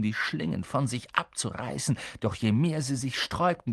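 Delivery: ordinary delivery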